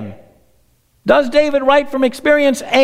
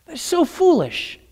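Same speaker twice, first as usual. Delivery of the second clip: higher and soft, "so" lifted; lightly voiced God, higher; 5 semitones higher, 5 dB quieter than usual. God laughs off the wicked's schemes, higher and soft. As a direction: lift the pitch, amused at their folly. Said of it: "God says".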